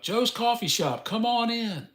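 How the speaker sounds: high sing-song welcome; hammy come-in call; shop greeter voice. Pretend. Mock shop-greeting pitch.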